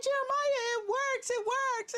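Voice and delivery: high, bright-voiced shout of joy; excited announcement. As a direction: burst in with the good news. Running to tell son, excited.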